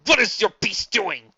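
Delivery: slow, loud, furious question; outraged demand. Acted. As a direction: demand answers in rage. Prince outraged at his crew.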